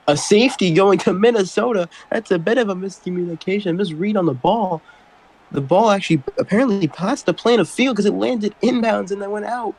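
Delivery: excited call. Real, not acted. Surprise safety, excited call.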